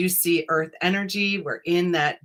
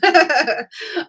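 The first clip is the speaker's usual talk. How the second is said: short, high giggle; high burst of laughter; 10 semitones higher, 6 dB louder than usual. Real, not acted. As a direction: laugh it off brightly. Laughs at the earthquake memory.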